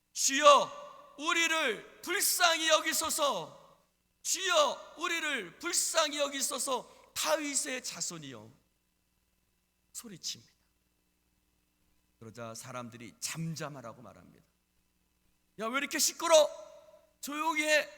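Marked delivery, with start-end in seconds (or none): 0.1-8.5 loud, high, drawn-out plea; crying out. Acted. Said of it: "그가 예수님을 향해서 소리칩니다". 15.6-17.9 loud, high rebuke from the crowd; scolding shout. Acted. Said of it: "그를 소리치고 꾸짖고".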